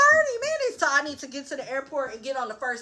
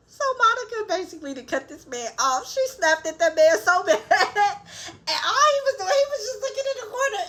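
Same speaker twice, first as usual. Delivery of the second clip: giggling through the words; high, laughing delivery; 9 semitones higher than usual. Real, not acted. Laughing retelling the scene.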